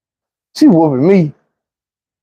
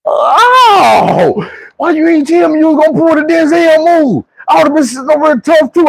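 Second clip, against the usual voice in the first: hollering complaint; 12 semitones higher than usual. Retold or imitated. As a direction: yell like a kid getting whooped. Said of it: "I start jumping and hollering".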